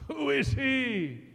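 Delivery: a lightly voiced mocker; mocking crowd. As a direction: voice a scoffer jeering at Jesus. Mockers on the Calvary road.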